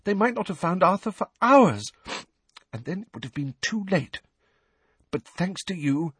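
a relieved mother thanking him; lightly voiced grateful mother. A narrator's reading. Mrs Weasley voiced in narration.

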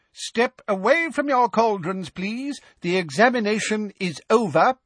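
put-on high, quavery old witch voice; formal elderly announcement; old examiner. A narrator's reading. Professor Marchbanks speaking.

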